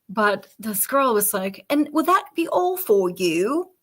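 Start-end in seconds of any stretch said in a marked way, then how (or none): 1.7-3.7 higher-pitched, hammed-up fake Cockney accent; bad fake accent. Cashier girl to a customer, in a shit fake accent. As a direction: mimic a badly faked Cockney accent. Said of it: "in this shit fake accent".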